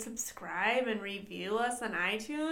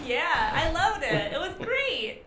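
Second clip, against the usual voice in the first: laughing, high and loud; 6 semitones higher, 6 dB louder than usual. Real, not acted. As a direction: giggle through the praise. Laughing after five bombs.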